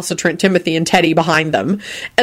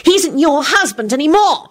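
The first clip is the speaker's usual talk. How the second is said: higher furious shriek; 9 semitones higher than usual. A narrator's reading. Solange to Loretta, higher furious shriek. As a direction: shriek at the rival. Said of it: "Solange shrieked".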